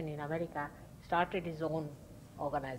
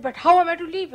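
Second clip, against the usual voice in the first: a sudden loud, higher outburst of temper; angry protest; 9 semitones higher, 15 dB louder, faster than usual. Retold or imitated. Disciple shouting back at Mother in a temper. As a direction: burst out defending his income. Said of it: "And he got into a temper".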